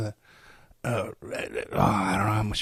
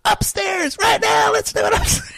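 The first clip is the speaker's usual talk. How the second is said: put-on high, loud drunken shouting girlfriend; drunk Holly voice; 5 dB louder than usual. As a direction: belt it out like a tipsy woman demanding a wrestle.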